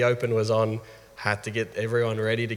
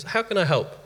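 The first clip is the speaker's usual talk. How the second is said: higher-pitched imitation of a young daughter; mock little-girl question, pitched up; daughter's voice; 10 semitones higher than usual. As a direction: lightly voice a child offering help. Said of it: "She goes".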